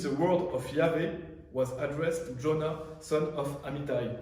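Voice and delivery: slow, careful English read with a French accent. Real, not acted. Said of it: "désolé pour mon ac-, mon accent". (anglais lu lentement avec un accent français)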